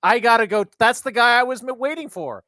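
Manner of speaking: high, urgent burst of recognition; sudden excited distraction. Acted. Player in character spotting the awaited guy, excited escape. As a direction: blurt it out high to get away.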